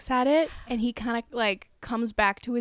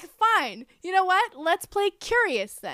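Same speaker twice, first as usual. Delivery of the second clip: higher-pitched, mock-irritated retort; acted exasperation; 7 semitones higher than usual. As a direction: play a bandleader giving in crossly. Acted angry rehearsal quarrel.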